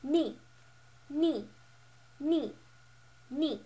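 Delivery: "ni" falling sharply from high; fourth tone. Shown as a demonstration. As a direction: slant "ni" firmly downwards. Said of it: "the slanted down one".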